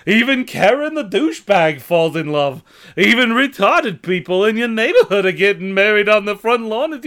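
loud high giggly comic voice; Ed Wynn. Acted. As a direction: trill it out like Ed Wynn. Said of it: "it's gonna be Ed Wynn".

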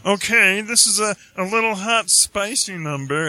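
a loose attempt at a smooth showman. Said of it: "I can't really do [name], but I was trying".

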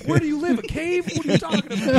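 high-pitched incredulous questions; mock exasperation. Moviegoer to a clueless friend, mock exasperation. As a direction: pitch it way up in disbelief.

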